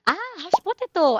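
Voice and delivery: ordinary delivery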